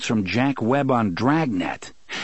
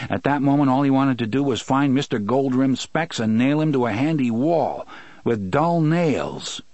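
ordinary delivery, nothing marked

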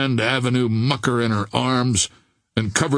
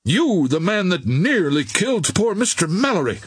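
higher, outraged cry; horrified accusation; 6 semitones higher than usual. A narrator's reading. Captain accusing Billy as a cutthroat, crying out. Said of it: "he cried".

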